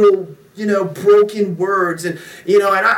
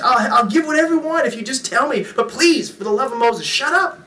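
high-pitched retold begging; mock desperate plea; 6 semitones higher than usual. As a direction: plead with a crying baby, playing it up. Begging crying baby to stop.

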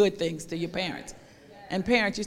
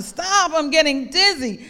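mock scream; 7 semitones higher, 5 dB louder than usual. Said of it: "I screamed".

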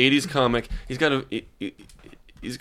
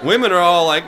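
usual speaking voice

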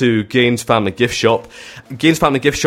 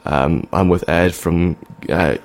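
laid-back mumbly singer chat, barely changed; 4 semitones lower, slower than usual. Acted. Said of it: "Here's, here's [name]".

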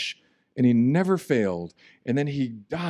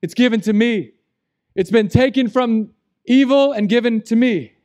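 louder, higher, emphatic declaration of authority; emphatic proclamation; 9 semitones higher, 7 dB louder than usual. Retold or imitated. Jesus claiming all authority, said loud and emphatic. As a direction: declare it with full conviction. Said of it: "He's saying".

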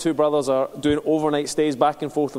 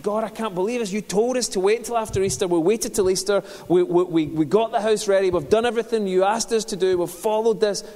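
higher and louder, pleading protest; venting at God, pitched up, a touch louder; frustrated complaint to God; 7 semitones higher, 3 dB louder than usual. Retold or imitated. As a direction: lay the complaint before God, exasperated. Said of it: "And I'm like".

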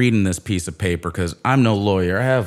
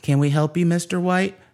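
polite teller; 9 semitones higher than usual. Retold or imitated. Bank teller greeting him.

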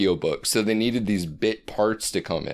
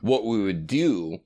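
usual speaking voice

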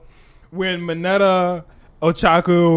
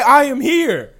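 mock hero catchphrase; 10 semitones higher, 5 dB louder, slower than usual. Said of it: "he didn't say".

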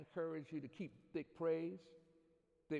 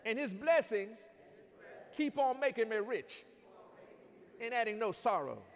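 fiery preaching, louder and higher; 6 semitones higher, 9 dB louder than usual. Acted. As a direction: preach it with rising fire. Climax of sermon shouted.

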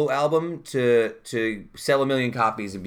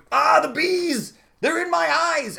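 high, slow mock panic scream; imitating Nicolas Cage; 4 dB louder, slower than usual. Host imitates the bee scene, high slow mock wail. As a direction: ham up Cage's bee meltdown. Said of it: "And he's just going".

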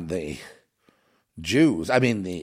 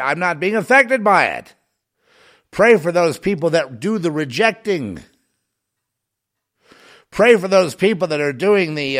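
higher, louder preaching cadence, hammering each pray; fervent preaching; 7 semitones higher, 7 dB louder than usual. Acted. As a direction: preach it at full force to the congregation. Impassioned appeal to pray.

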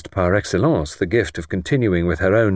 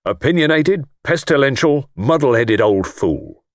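contemptuous; 6 semitones higher than usual. Acted. Lord denouncing the dead doctor.

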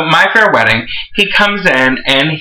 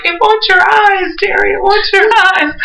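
hammed-up horror movie wail; mock shriek. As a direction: scream it like an over-the-top psychic. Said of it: "be like".

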